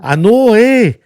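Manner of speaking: loud, drawn-out Canadian impression; hammed-up Canadian with a big eh; strong Canadian accent. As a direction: imitate a thick Canadian twang. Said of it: "a stronger Canadian accent".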